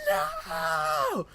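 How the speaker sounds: long, very high mock scream; horrified wail. Girl refusing to stop the race, horrified wail. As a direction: wail it in drawn-out anguish.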